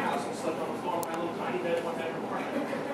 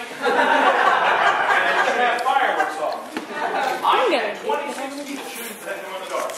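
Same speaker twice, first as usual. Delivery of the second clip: laughing; 8 semitones higher, 9 dB louder, slower than usual. Real, not acted. Laughing at ghost story punchline.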